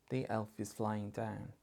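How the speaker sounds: mildly sad, subdued delivery. Shown as a demonstration. Teacher models a sentence for parents, mildly sad. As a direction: say it with a touch of sadness. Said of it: "Say it in a sad voice".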